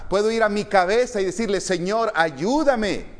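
loud, high sermon delivery; high, ringing plea from the pulpit; impassioned preaching. Acted. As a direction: preach it out forcefully to the congregation. Preaching at full force.

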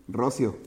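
"Rocio" with no stress on the I; misplaced accent. Shown as a demonstration. Teacher repeating the wrong form, "Rocio" without its accented I. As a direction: say "Rocio" low and soft, accent off the I. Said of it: "Porque si no, sería".

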